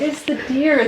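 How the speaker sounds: high falling "deer", explaining with amusement; the supervisor lets her in on the joke; retold supervisor. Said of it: "And he's like".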